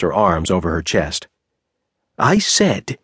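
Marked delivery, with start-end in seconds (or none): 2.2-3.0 calm with edge. A narrator's reading. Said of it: "he spoke in a calm voice, laced with edge".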